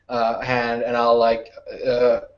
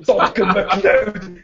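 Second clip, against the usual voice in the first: a higher mock Scottish name call; mock Scottish accent; 8 semitones higher than usual. Player teasing the Scottish voice with a mock accent. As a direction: tease with a Highlander accent. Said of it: "making fun of your accent".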